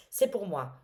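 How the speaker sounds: breaks into a laugh at herself; a real laugh, then the words still laughing. Real, not acted. Laughing at herself while telling viewers about numbers. Laughs at her own remark.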